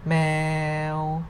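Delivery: "Meow" drawn out on a level pitch; mid tone. Shown as a demonstration. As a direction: hold "Meow" long and even. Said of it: "no tone mark represent the mid tone".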